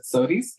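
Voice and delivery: imitated slip into Southern "sodies". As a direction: quote your own Southern "sodies". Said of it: "wasn't paying attention to how I was speaking".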